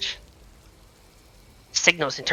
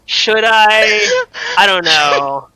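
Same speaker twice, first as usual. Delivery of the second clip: louder, higher giddy temptation; tempted; 9 semitones higher, 10 dB louder, much slower than usual. Retold or imitated. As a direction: tease the naughty idea. Retelling own temptation.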